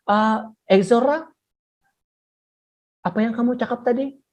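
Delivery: examiner asking, not having heard; lightly voiced confused examiner; puzzled examiner. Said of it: "pentaksir kata". (pentaksir keliru bertanya)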